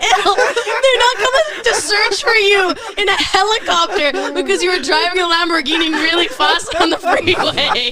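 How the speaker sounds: high, loud, drawn-out through laughter; laughing disbelief. Retold or imitated. Friends telling Ilya nobody is searching, laughing disbelief. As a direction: cackle your way through the reassurance.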